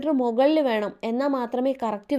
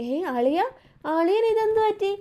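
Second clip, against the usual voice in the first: high-pitched, lightly acted surprise; mock surprised question; 9 semitones higher than usual. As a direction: imitate a startled brother-in-law asking what happened. Brother-in-law finds the injured man.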